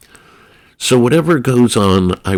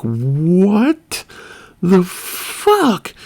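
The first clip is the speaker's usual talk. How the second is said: stunned disbelief; 9 semitones higher, much slower than usual. Retold or imitated. Stunned at friend's size.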